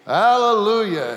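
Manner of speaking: loud, high triumphant shout; shouted Hallelujah. Acted. Man on his new donkey, shouting it loud and high. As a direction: call it out to get the donkey moving. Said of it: "he shouted".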